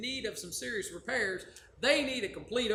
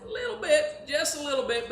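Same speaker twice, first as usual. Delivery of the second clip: playful admission; 5 semitones higher, 5 dB louder than usual. Acted. Admits sarcasm playfully.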